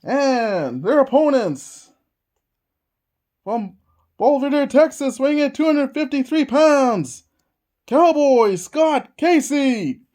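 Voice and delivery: names stretched out slow; drawn-out ringside introduction; ring announcer intro. Acted. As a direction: boom out each name like a ring announcer.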